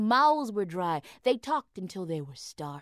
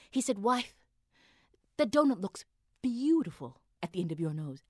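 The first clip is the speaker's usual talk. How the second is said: plain reading voice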